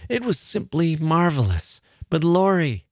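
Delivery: high, swooping tearful voice, mildly acted; sobbed. A narrator's reading. Sister telling her brother about it, lightly sobbed. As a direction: let it rise as if tearful. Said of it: "sobbed Laura".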